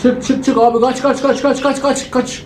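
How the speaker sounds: high-pitched frantic run cries; fleeing, frantic and high; panicked urging. Real, not acted. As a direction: urge yourself on in sheer panic.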